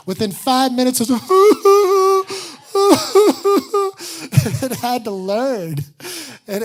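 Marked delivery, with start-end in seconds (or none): 1.3-4.6 a high, loud laugh that breaks into crying; real laugh cracking into a cry; laughing sob. Real, not acted. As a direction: laugh it out as the tears come. Breaking down in counseling group.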